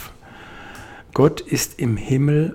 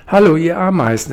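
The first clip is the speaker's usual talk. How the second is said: higher, louder call down to the ants; calling loudly; 5 semitones higher, 6 dB louder, faster than usual. Acted. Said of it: "rief er laut".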